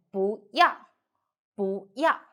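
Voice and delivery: 不要 with rising 不 and falling 要; changed second tone. Shown as a demonstration. Teacher has learners read 不要, rising 不 before falling 要. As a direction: raise 不, then let 要 fall. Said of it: "Read that, 不要".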